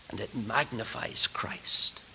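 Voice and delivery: slow, soft, near-whispered awe; reverent hush. Real, not acted. Preacher exalting Christ to fellow ministers, in a hush. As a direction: drop right down in reverence. Sudden hush after proclamation.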